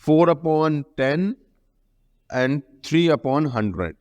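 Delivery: a definition read very slowly for copying; slow teacher dictation. Acted. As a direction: dictate it word by word so pupils can write.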